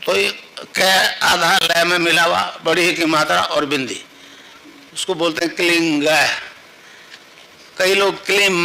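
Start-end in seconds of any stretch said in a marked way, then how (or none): none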